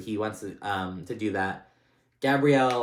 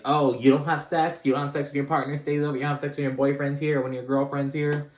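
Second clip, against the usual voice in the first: calling out; 5 semitones higher, 3 dB louder than usual. Retold or imitated. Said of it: "he kind of calls the kids out".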